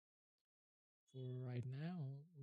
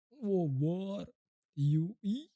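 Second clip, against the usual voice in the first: surprised; 5 semitones higher, 8 dB louder, much slower than usual. Real, not acted. Confused by the vowel guide.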